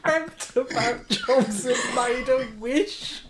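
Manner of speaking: high, slow, laughing repeat; incredulous laughter. Retold or imitated. Friend repeating an absurd job-search entry, laughing hard. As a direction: squeeze the words out through helpless laughter.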